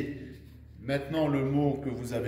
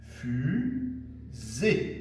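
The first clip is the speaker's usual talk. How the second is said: « fusée » in two slow, rising beats; split into syllables. Shown as a demonstration. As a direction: say « fusée » as fu, long pause, zée. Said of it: "deux syllabes". (« fusée » découpé lentement en deux syllabes)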